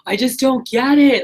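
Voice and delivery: a hint of a crying, upset sister; retold distraught cry. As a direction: replay her anguished phone call, half-voiced. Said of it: "her screaming and crying".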